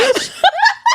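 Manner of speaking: high shrieking laugh. Real, not acted. Laughing at her own vomit story, high shriek. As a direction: burst into a squealing laugh.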